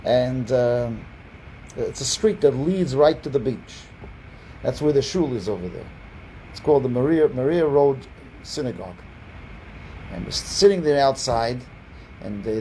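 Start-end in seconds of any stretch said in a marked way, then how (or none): none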